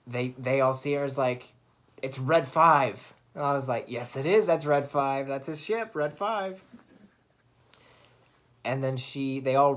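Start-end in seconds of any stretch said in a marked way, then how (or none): 2.0-3.1 loud, stretched-out acted cheer; excited exclamation. Characters spot Rey flying in Luke's ship, excited shout. 3.9-6.6 loud at first, climbing higher at the end; retold excitement. Fan answering the screen as the ship appears, excited. Retells own excited reaction to film.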